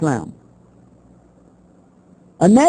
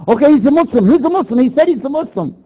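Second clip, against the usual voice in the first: mock eager announcing; 6 semitones higher, faster than usual. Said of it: "They walk up and say".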